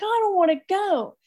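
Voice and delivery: put-on high, childlike whine; a high, pleading little protest; whiny. Reluctant sister whining to her brother, high.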